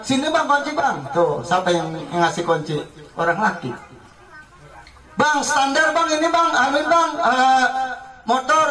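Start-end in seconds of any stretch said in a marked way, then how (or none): 0.0-1.1 high, sweet call for the keys; a wife calling high for the motorbike keys. Retold or imitated. Wife asking husband for key. 5.1-8.7 very high, fussy calls to her husband; a wife helplessly calling her husband to handle the motorbike; flustered wife. Retold or imitated. Wife fussing at husband over motorbike.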